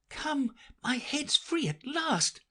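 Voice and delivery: put-on high, slow, delighted girl. Alice relieved her head is free, delighted high voice. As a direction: savour the relief like a happy child. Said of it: "in a tone of delight".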